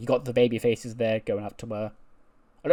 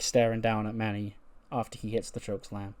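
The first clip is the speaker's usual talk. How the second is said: normal talking voice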